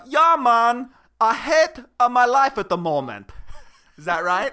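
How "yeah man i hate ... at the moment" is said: loud, high mock Jamaican accent; fake Jamaican accent. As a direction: belt out a badly faked Jamaican caricature. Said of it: "Give me Jamaican".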